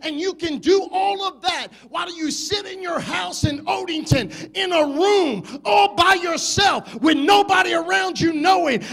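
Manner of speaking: building to a roaring shout; full-force preaching. Acted.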